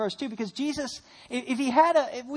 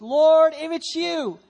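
shouted Lord, higher and louder; calling out; 6 semitones higher, 10 dB louder, much slower than usual. Acted. Peter calling to Jesus on the water, loud call. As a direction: call out across the waves to Jesus.

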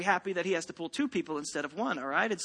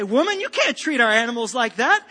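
husband loudly scolding wife, rising higher; outraged shout; 6 semitones higher, 5 dB louder than usual. Acted. Husband yelling at his wife over the shot mule, loud and rising. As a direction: yell it at her, pitch climbing with outrage. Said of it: "And the wife let him shout".

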